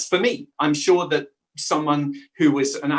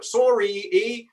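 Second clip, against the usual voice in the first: higher, caricatured Canadian lilt; fake Canadian accent; 7 semitones higher, slower than usual. Shown as a demonstration. Briton shows sounds put into fake Canadian accents. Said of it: "our fake Canadian accents".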